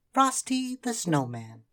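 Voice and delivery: high, loud, slow proud naming; children naming. A narrator's reading. Children naming their new snowman. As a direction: announce the name proudly.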